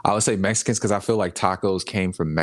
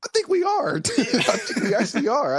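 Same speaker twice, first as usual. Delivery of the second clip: laughing realisation. Real, not acted. Laughing admission.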